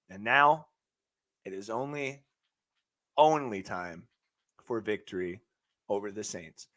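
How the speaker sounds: low, hushed hype voice; dramatic hush. Acted. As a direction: drop it low and build suspense. Hyping next game, dropped low.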